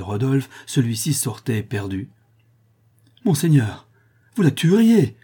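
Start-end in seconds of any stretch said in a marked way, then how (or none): 3.2-5.2 loud, high-pitched urgent warning; alarmed cry. A narrator's reading. Squire holds back the prince, crying out in alarm. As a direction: cry out to stop him, pitch leaping up. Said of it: "s'écria le squire en retenant le prince".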